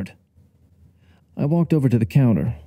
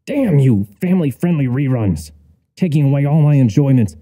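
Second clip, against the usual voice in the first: raised, hammed-up outrage; indignant cursing; 4 semitones higher, 4 dB louder than usual. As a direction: rail at the television. Narrator cursing the TV.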